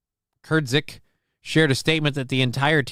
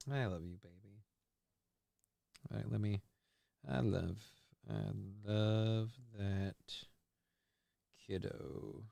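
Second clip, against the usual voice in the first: tender; 4 semitones lower, 19 dB quieter than usual. Real, not acted. Looking at daughter's picture.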